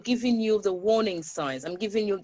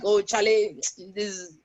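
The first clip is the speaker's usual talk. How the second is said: put-on Ghanaian accent; 3 dB louder than usual. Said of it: "I think I'm just faking it".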